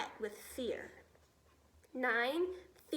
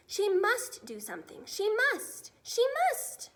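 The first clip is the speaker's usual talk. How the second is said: rising higher and louder each time; building urgency; 8 semitones higher, 6 dB louder than usual. A narrator's reading. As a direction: press harder on each must.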